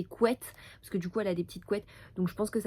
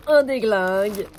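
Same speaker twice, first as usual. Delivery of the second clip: loud excited exclaim; 11 dB louder than usual. Real, not acted. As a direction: burst out, much louder. Loud exclamation at huge package.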